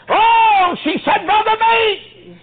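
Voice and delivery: high, loud, drawn-out wail of grief; anguished cry. Retold or imitated. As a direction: cry out his name in anguish. Grieving daughter cries out to preacher.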